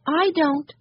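put-on high little-kid reply; Benny's child voice. A narrator's reading. Benny answering Henry about museums, high child voice. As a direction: pipe up high as a small child. Benny admits he does not know.

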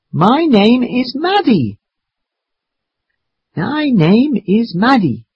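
drawn-out, high-pitched repetition; slow and loud. Acted. Maddie repeats her name to grandfather, slowly and loudly. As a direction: spell it out slowly, pitched well up. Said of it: "He asks me to speak slowly and loudly".

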